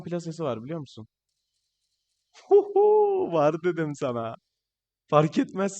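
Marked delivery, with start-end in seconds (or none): 2.5-3.3 a sudden high delighted hoot; gleeful whoop. Real, not acted. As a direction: whoop with joy at the goal. Celebrating a goal in game. (sevinçle yüksek bir çığlık)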